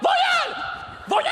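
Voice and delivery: « Voyelle! » crié très haut; enfant qui crie. Retold or imitated. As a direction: hurler comme un gamin. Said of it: "C'est comme". (imitation d'un enfant anglophone qui hurle, très aigu)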